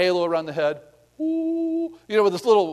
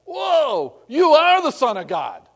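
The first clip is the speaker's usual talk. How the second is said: high, loud astonished cry; amazed; 10 semitones higher, 7 dB louder, slower than usual. Retold or imitated. Nathanael amazed before Jesus, high and loud. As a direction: gasp it out in wonder. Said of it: "But Nathanael says".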